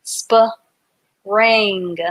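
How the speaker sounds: "Sp-ring" split into "sp" then "ring". Shown as a demonstration. Teacher sounding out "Sp-ring" for the class, split. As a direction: sound out "Sp-ring" in two parts. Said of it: "Ring is spelled R I N G".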